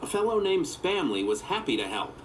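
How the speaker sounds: ordinary delivery, nothing marked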